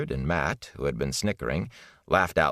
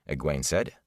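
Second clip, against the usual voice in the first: ordinary delivery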